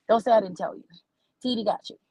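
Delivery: normal talking voice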